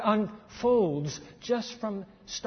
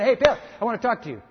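quick mock angel greeting; 4 semitones higher, 6 dB louder, faster than usual.

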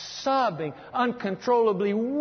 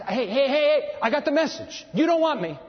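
raised, higher-pitched retort; voicing God, louder and insistent; God speaking up; 5 semitones higher, 4 dB louder than usual. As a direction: lift the voice to cut them off. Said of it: "And so God says".

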